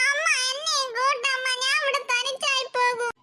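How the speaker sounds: put-on crying child. Little sister crying to her mother not to be left behind. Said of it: "പിന്നെ നിനക്ക് കരയുന്നത്?".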